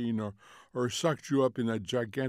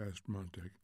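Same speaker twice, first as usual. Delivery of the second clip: usual speaking voice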